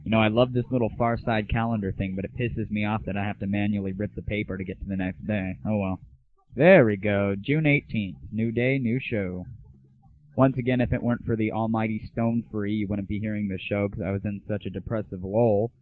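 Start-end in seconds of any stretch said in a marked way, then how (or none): none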